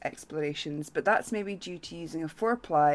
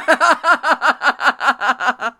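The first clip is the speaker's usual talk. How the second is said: helpless cackle; real laughing fit; loud burst of laughter; 7 semitones higher, 12 dB louder than usual. Real, not acted. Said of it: "That was so funny".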